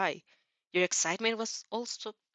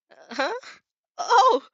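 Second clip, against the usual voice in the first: a startled, much higher and louder "Oh!"; flustered surprise; 11 semitones higher, 11 dB louder, slower than usual. A narrator's reading. Izuku is asked if she looks pretty, startled yelp. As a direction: caught off guard, yelping in embarrassment.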